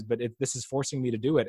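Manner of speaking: ordinary talk, nothing marked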